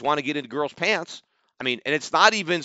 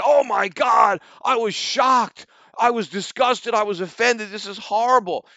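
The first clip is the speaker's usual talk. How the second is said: hammed-up shocked outrage, much higher and louder; hammed-up horrified gasp of a critic, raised and loud; mock outrage; 7 semitones higher, 4 dB louder than usual. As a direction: parody a critic clutching his pearls. Mocking a critic's tweet.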